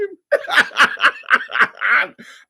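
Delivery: long, high cackle; mocking laugh. Real, not acted. Laughing at someone's excuses, high derisive cackle. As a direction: burst into a loud, high laugh.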